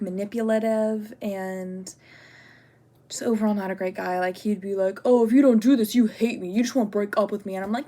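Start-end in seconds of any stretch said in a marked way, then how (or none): 5.0-7.5 lightly acted accusing ex, loud at first; mock guilt-tripping. Manipulative ex pressuring the speaker to stay, mock accusing. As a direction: imitate a guilt trip, starting loud and easing off.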